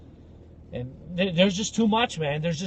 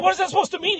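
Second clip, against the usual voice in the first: alarmed question; 13 semitones higher, 3 dB louder, much faster than usual. Retold or imitated. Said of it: "I was like".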